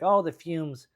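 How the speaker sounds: high, loud Jersey woman; Jersey accent. Acted. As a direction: fret in a put-on Jersey accent. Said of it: "do the Jersey accent".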